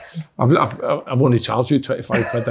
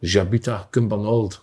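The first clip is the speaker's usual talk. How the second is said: put-on slow, low, soft French-accented line; lightly put-on French accent, drawn out; 7 semitones lower, 6 dB quieter, slower than usual. Lightly voiced French accent, for the podcast crew's request. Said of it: "a French accent".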